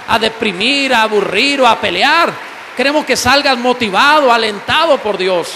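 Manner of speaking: loud, fervent preaching to the congregation; loud sermon shout; full-force preaching. Acted. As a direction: proclaim it loud like a revival preacher.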